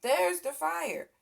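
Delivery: ordinary delivery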